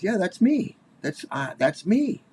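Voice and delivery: high, lightly voiced proud man; proud grown man. Grown man showing the comedian his childhood photo, high and proud. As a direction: play him chirpy and pleased with himself, pitched high.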